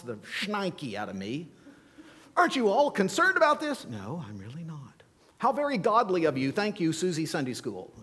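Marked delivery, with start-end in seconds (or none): 2.4-3.8 fast, high, fretting question; husband fretting to his calm wife, high and fast; worried husband. Retold or imitated. Preacher mimics his own worried self. 3.8-5.0 calm wife. Retold or imitated. Wife Sheila's calm reply voiced. 5.4-7.9 fast, high mock praise; husband teasing his unbothered wife, high and sarcastic. Sarcastic retort to Sheila.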